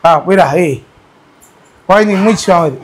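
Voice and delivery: ordinary talk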